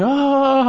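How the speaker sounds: high, brief fake mourner's cry; a quick imitation of the wailing crowd; mock wail. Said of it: "people are wailing".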